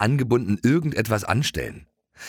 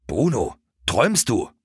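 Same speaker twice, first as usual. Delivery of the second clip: hissed, urgent pony voice; mock hiss to wake the dog; lightly hissed call to a dreaming dog; 3 semitones higher than usual. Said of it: "zischte Schoko".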